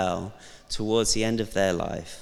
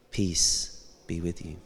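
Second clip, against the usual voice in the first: gentle blessing; 4 semitones lower, 7 dB quieter than usual. Pretend. Jesus greeting frightened disciples.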